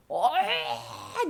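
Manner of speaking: long, high noise of revulsion; acted groan of disgust; disgust at stench. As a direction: recoil from a foul smell, drawn out. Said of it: "냄새 너무 나니까".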